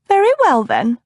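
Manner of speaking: put-on high, fussy older lady voice; fussy older cousin. A narrator's reading. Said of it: "the other lady replied".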